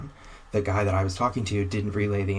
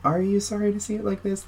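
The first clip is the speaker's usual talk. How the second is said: high mocking echo of their words; sarcastic retort; 12 semitones higher than usual. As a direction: throw their line back at them, scornfully high. Sarcastic retort to dealership staff.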